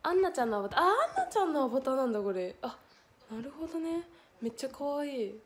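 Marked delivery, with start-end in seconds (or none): 0.8-2.2 早口で声が跳ね上がる驚き; はっと気づいて一気に言う; 気づいた驚き. Real, not acted. アバターに気づいて驚く. (驚いて早口に)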